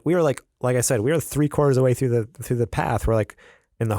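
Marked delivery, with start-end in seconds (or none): none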